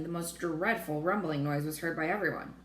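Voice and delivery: ordinary delivery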